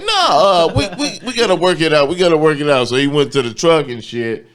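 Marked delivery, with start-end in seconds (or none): 0.0-2.9 high, loud, flustered trainer pleading; mock trainer voice. Imitating the trainer to a friend, high and loud. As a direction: play the trainer squirming out of it, pitched way up.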